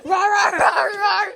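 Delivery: loud, high playful roaring; dinosaur roar. Pretend. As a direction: roar like a T-Rex. Kid roars as dinosaur.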